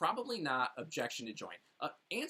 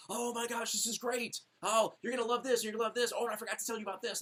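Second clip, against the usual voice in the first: higher, gushing, tumbling over the words; overexcited overselling; 8 semitones higher, 3 dB louder, faster than usual. Retold or imitated. Seller overwhelming a buyer who just said yes, gushing. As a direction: gush about everything at once. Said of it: "You'd be like".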